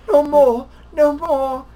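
high, loud pleading cry; imitating Austin Powers. Retelling the Mini-Me fight scene, high pleading squeal. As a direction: squeal it out like you're being beaten. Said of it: "and he's like".

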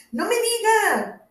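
high, drawn-out exclamation of surprise; surprised reply to a stranger. A narrator's reading. Fiona reacts to the American.

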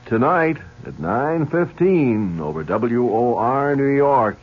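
normal talking voice

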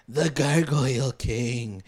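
slow, drawn-out spooky naming; ominous reveal. Retold or imitated. Scared friend names the villain to Jughead, ominous. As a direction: announce it slowly, like a dreaded villain.

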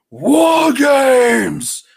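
high, loud announcer shout; Regal announcement. Retold or imitated. William Regal announcing to the crowd, loud high shout. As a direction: belt it out like a big reveal.

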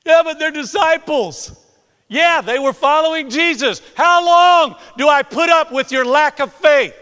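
high, very loud outburst; exasperated shout. Acted. Preacher acting Jesus fed up with disciples, exasperated shout.

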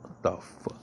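a hushed, breathy aside under his breath; whispered to himself. Real, not acted. Vlogger reading weird emails, whispering in surprise. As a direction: mutter it in a whisper as if reading something odd. Distracted by emails mid-vlog.

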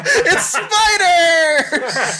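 high, loud, slow laughing cry; mock reveal. Father acting out the spider cake reveal, laughing high. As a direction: cackle out the reveal.

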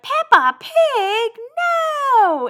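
high, loud, incredulous squawk. Retold or imitated. Singer refusing a Peppa Pig duet, mock-outraged squawk. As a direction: stretch each word in mock outrage, very high. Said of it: "She's like".

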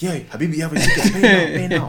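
hammed-up Indian accent that breaks into laughter; Indian accent, laughing. Scam caller demanding a ticket payment, in a laughing mock Indian accent. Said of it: "in an Indian accent".

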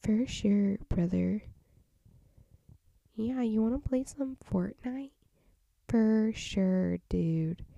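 lightly voiced gamer bro, pumped up and loud. Whispering vlogger breaks into a loud gamer bro voice. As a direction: hype it up like a Fortnite buddy. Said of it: "I act away".

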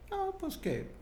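acted clueless hesitation. Acquaintance asked about Manuel stalls, high and hesitant. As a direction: pitch way up as if searching for anything to say.

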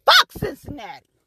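normal talking voice

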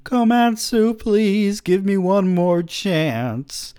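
high, loud, slow begging; pleading cry. Acted. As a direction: plead with everything left. Fatty begging his friend.

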